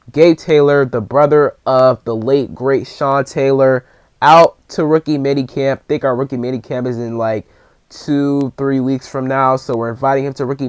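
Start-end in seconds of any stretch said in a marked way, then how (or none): none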